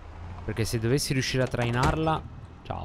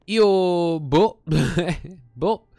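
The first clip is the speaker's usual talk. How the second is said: laughing; 8 semitones higher, 5 dB louder, much slower than usual. Real, not acted. Laughing in disbelief at the find.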